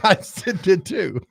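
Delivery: giggling through the words; laughing. Real, not acted. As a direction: laugh while you admit it. Laughing at the joke.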